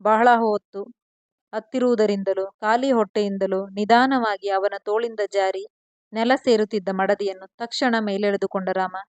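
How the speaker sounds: usual speaking voice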